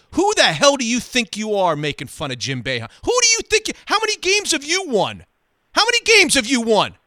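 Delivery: high, loud furious tirade; screaming rage. Retold or imitated. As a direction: pound the table and demand an answer. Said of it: "He starts screaming at me".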